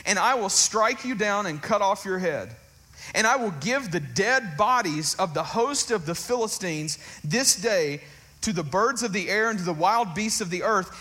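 put-on defiant shepherd boy declaring victory, preached loud; David's battle cry. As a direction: proclaim it like a young warrior facing a giant. Preaches David's speech at full force.

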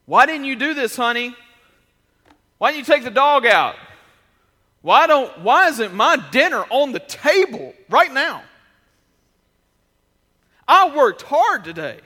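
loud, high, exasperated complaining; demanding husband. Pretend. Husband demanding answers from his wife, loud and exasperated. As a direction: whine and demand like an entitled husband.

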